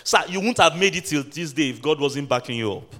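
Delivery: ordinary delivery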